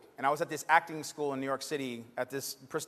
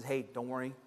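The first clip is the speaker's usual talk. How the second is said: softer, lower, gentle calming voice; reassuring teacher; 4 semitones lower, 7 dB quieter, slower than usual. Retold or imitated. Acting teacher calming a panicking student, softly reassuring. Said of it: "he said".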